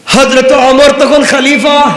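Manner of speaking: high, loud sermon delivery; impassioned preaching. Acted. Preacher telling the story of Husain to the crowd, impassioned. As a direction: sweep the crowd along in full voice.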